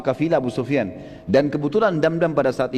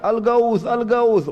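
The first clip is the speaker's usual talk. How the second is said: higher, urgent call for help; crying for help; 7 semitones higher, much slower than usual. Retold or imitated. Damdam calling to gathering Meccans, high urgent cry. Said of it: "dia terus teriak".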